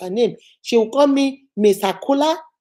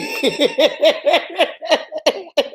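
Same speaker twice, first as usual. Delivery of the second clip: long run of giggles; amused, drawn-out laughter; real laugh; 6 semitones higher than usual. Real, not acted. Laughs at her old self.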